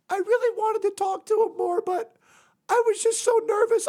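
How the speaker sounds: put-on very high-pitched, upset girl voice; Sophia. Friend confessing she was too nervous to talk, high voice. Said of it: "she was just like".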